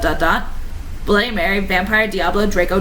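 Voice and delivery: usual speaking voice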